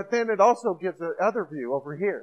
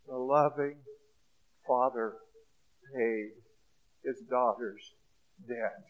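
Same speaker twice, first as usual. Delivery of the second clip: soft, lower, word by word with pauses; 7 semitones lower, 7 dB quieter than usual. Acted. Preacher landing the story's point, hushed. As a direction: let each word land quietly.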